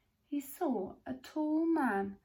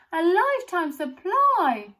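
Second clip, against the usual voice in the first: high, loud envious complaint; 9 semitones higher, 13 dB louder, slower than usual. A narrator's reading. A boy in the crowd, envious of Charlie's free supply. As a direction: grumble it with envy. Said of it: "another boy said enviously".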